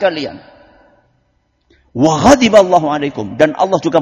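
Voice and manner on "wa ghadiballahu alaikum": angry. Acted.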